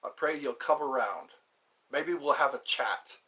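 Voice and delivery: lightly put-on British accent; mock British, matey and conversational. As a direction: talk like a chummy Brit, playfully. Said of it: "How's my British accent?".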